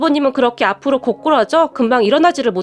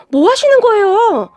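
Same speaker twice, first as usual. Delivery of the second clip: high, drawn-out cry of alarm; alarmed and slowed, pitched well up; startled protest; 8 semitones higher, 4 dB louder than usual. A narrator's reading. Wife breaking up the brothers' fight, startled protest. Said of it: "제가 깜짝 놀라서".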